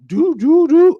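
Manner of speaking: ringtone imitation. Imitating the phone call ringing, sung tones. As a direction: hum a phone ring. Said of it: "I get another phone call".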